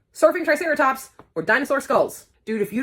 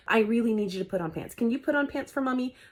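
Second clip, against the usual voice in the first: normal talking voice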